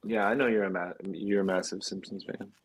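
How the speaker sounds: ordinary talk, nothing marked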